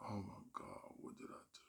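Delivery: unvoiced dismayed whisper; whispered despair. Comic alone in green room, whispering despair. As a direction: breathe it out in shock.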